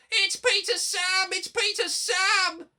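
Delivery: very high, loud desperate wail. A narrator's reading. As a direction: warn them in panic. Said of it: "wailed the empty trucks".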